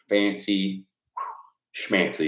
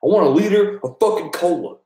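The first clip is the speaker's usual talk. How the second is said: loud, higher Farva voice; Farva impression; 10 semitones higher, 4 dB louder than usual. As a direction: bark it like Farva demanding cola. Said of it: "I do have a sort of a Farva thing".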